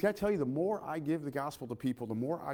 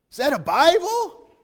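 surprised question; 12 semitones higher, 10 dB louder, slower than usual. Retold or imitated. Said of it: "They're like".

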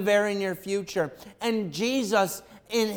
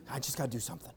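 low, soft resigned murmur; muttered; 7 semitones lower, 13 dB quieter, much faster than usual. Retold or imitated. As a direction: say it under your breath as you busy yourself. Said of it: "mom goes over and she starts doing dishes".

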